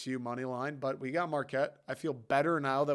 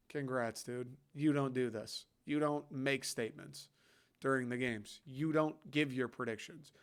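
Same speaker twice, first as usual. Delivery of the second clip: normal talking voice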